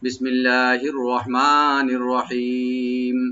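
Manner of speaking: slow, chanted tajweed reading; Quranic recitation. Shown as a demonstration. Teacher begins recitation for students, chanted slowly. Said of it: "right দিয়ে পড়ার চেষ্টা করবো".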